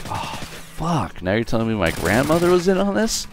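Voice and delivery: mock horror, pitch jumping up; dismayed disbelief. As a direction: react aghast, voice climbing high. Dismayed reaction to grandmother's involvement.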